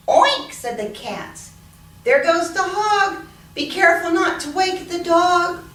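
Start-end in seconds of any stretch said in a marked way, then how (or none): 0.1-0.5 high, loud oink; piggy oink. Acted. Cats imitate hog. 2.0-5.6 higher, sneaky thief voice. Acted. Thief Lynn whispers on.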